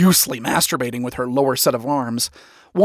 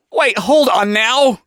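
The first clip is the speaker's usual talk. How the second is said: high, louder drawn-out plea; pleading; 7 semitones higher, 5 dB louder, slower than usual. A narrator's reading. Lucifer pleads to the crowd, urgent. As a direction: plead to halt a hostile crowd. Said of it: "Lucifer pleaded to the crowd".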